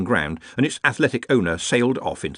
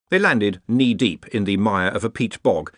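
plain reading voice

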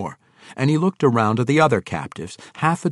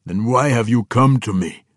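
louder, lightly voiced giant's demand; 5 dB louder than usual. A narrator's reading. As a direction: demand an explanation from your visitor. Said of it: "the frost giant demanded".

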